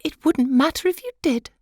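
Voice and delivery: high, light wife's voice; high pitched fussy little wife; butterfly wife. Acted. Wife taunts husband.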